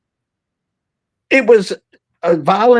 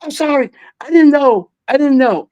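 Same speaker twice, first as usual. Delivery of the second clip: sheepish apology; 7 semitones higher than usual. Retold or imitated. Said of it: "he was like".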